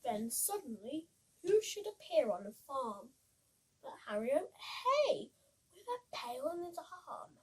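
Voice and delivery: put-on lively read-aloud for children; bouncy rhyming picture-book voice; storybook reading. A narrator's reading.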